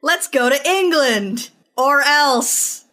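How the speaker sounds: hammed-up menace; mock threat.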